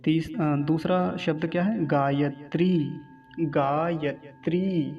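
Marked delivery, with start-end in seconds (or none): 1.9-4.9 slow, syllable by syllable "गायत्री"; careful gaa-yat-ree with long ending; long final ee. Shown as a demonstration. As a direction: stretch the last vowel of "गायत्री". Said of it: "दीर्घ ईकार है".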